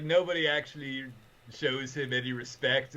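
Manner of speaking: ordinary talk, nothing marked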